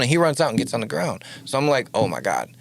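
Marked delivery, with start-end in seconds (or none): 1.9-2.5 low, hushed mock panic; quiet retold dread; panicked muttering. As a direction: mutter it under your breath, scared. Frightened muttering under robbery.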